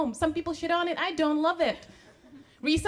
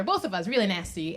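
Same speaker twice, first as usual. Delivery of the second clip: ordinary delivery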